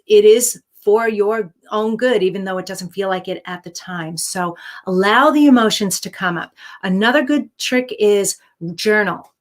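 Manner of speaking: usual speaking voice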